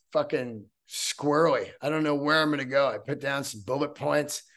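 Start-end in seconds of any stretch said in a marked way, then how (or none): none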